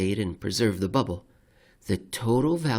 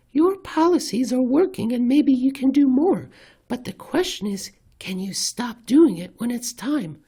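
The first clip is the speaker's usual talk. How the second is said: much higher, louder put-on reporter voice; Bloomberg reporter impression; 14 semitones higher, 5 dB louder than usual. Bloomberg reporter pressing Powell on stopping, much higher put-on voice. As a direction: squeak the question up like a pushy reporter. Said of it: "went through four or five different characters".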